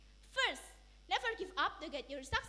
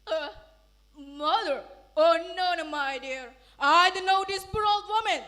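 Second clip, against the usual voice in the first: louder, dismissive denial; arrogant; 10 dB louder than usual. Acted. Rich son denying his mother before his wife, arrogant. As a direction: brush her off. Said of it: "He answered arrogantly".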